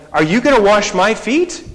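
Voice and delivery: high, loud disbelieving question; incredulous. Retold or imitated. Peter asks Jesus, stunned and disbelieving. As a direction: Peter can't believe his ears. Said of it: "he's incredulous".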